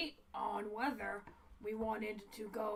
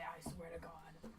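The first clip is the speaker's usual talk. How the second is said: whispered threat; 4 semitones lower, 7 dB quieter than usual. Real, not acted. Hissed threat to Charlotte.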